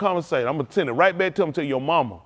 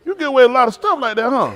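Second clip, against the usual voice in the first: high, loud talk through laughter; real laughing fit; 11 semitones higher, 9 dB louder than usual. Real, not acted. Preacher tickled by someone's smooth trick, loud real laughing. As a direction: crack up while talking, pitched way up.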